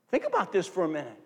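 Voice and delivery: ordinary talk, nothing marked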